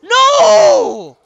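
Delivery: very high, loud wailed "no"; long piercing cry of dismay; dismayed scream. Real, not acted. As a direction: scream it out in despair. Dismay at losing in game.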